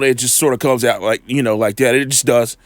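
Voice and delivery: ordinary talk, nothing marked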